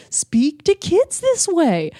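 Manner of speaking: high, slow sing-song talk; mock baby-talk voice. Shown as a demonstration. Mocking adults who talk to kids, baby-talk voice. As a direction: put on a voice for children, mocking it. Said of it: "when adults change their voice".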